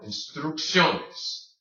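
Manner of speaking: instrucciones slowly, with a strong SYOH; hard O. Shown as a demonstration. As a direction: lean on the O in instrucciones. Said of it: "say that O hard".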